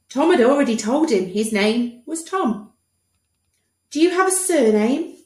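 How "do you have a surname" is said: high, louder doctor voice. Acted. Doctor to Tom, high bright question. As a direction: ask it brightly.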